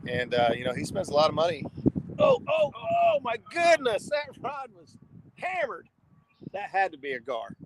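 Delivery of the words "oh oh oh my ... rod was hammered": sudden high excited cry; startled high exclamation; surprised. Real, not acted. A rod suddenly gets hit.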